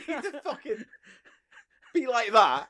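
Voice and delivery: high giggling through words; can't stop laughing. Real, not acted.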